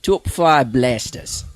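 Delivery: slow, badly faked Australian cartoon accent; Rocco's Modern Life accent. Shown as a demonstration. Co-host dared to do the top five, mock Australian cartoon accent. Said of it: "a Rocco's Modern Life accent".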